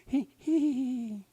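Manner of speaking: high-pitched silly giggle; giggling hee hee. Acted. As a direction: giggle like a lovestruck muskrat. Lovestruck Muskrat giggles.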